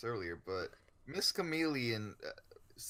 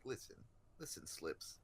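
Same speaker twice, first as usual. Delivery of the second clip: 1 gentle comfort; 7 semitones lower, 9 dB quieter than usual. Retold or imitated. Imagined teacher comforting student.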